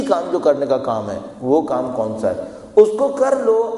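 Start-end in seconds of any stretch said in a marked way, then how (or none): 2.8-3.7 emphatic urging. Real, not acted. Preacher urging the real achievement.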